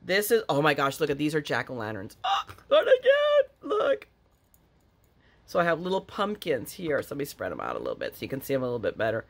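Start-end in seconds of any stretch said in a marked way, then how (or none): 2.2-4.1 high, squealed cry of delight; gushing. Real, not acted. To viewers showing jack-o'-lanterns, gushing squeal. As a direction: coo over the cute pumpkins.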